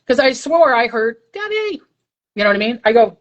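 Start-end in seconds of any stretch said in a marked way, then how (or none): 1.3-1.8 a light, high ghostly "Debbie"; lightly voiced spirit calling out, softer. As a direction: imitate a faint spirit calling her name. Ghostly voice calling her name.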